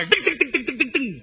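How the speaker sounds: evenly repeated gun thuds; imitated heavy machine gun. Father firing a heavy machine gun, imitated shots. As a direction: mimic a machine-gun burst. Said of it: "一阵重机枪啊".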